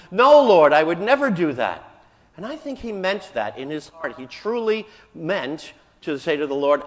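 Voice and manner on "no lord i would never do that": protesting. Retold or imitated. Peter protests to Jesus.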